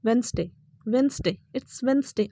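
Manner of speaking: वेडनेसडे said wens-day, d silent; correct Wednesday. Shown as a demonstration. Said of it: "correct pronunciation". (वेडनेसडे को वेन्सडे बोलें)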